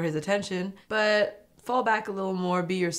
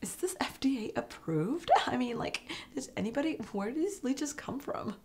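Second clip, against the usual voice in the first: hushed suspicious whisper, pitched up; 7 semitones higher, 6 dB quieter than usual. Retold or imitated. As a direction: whisper your doubts to the others. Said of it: "classic American, is just like".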